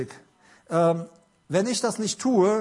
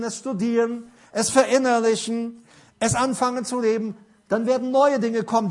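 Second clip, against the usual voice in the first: higher and louder, driving preacher's build; fervent sermon voice, pitched up and pushed; full-force preaching; 5 semitones higher, 3 dB louder than usual. Acted. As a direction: preach it out to the congregation with rising fervour. Preaching, voice raised throughout.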